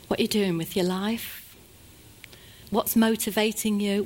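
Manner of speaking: normal talking voice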